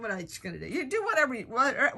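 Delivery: usual speaking voice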